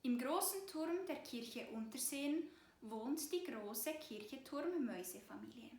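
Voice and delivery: ordinary delivery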